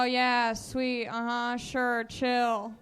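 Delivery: ordinary talk, nothing marked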